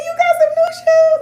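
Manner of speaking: high, loud, teary delight; excited aunt. Retold or imitated. Aunt answering her niece, high and choked up. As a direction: gush with teary joy. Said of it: "I said".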